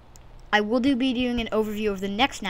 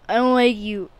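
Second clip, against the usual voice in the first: louder telling off; louder told-off line; 8 dB louder than usual. Real, not acted. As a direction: push it out louder. Louder repeat.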